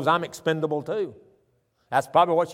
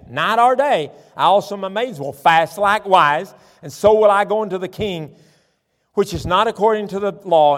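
forceful scripture reading; 3 semitones higher, 5 dB louder than usual. Real, not acted. Preacher reading Esther's answer to the congregation, mounting preaching force.

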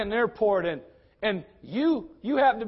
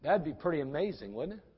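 ordinary talk

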